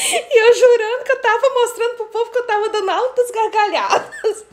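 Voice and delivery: high voice shaking with laughter; real giggles all through; laughing confession. Real, not acted. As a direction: admit the blunder while cracking up. Laughs at own mistake.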